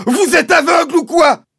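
screaming himself hoarse; Mister Hazel bawling. A narrator's reading. Landowner pointing out evidence to the sergeant, high bawling. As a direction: yell at the top of the voice, high. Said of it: "s'écosillait mister Hazel".

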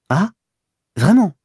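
surprised and flattered; pleased surprise. A narrator's reading. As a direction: perk up at the compliment. Duroy flattered.

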